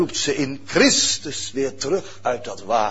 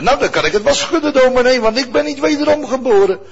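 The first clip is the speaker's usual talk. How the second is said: higher, louder, fast voice of a doubting listener; mock objection; 9 semitones higher, 8 dB louder, faster than usual. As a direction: toss in the hearer's protest. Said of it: "imagined listener objection". (een twijfelende toehoorder, luid en snel)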